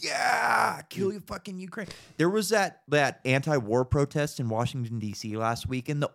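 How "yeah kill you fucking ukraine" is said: high, shouted mockery of war cheerleaders; mock gung-ho yell. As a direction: yell it like a brainwashed crowd cheering. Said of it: "everybody's just like".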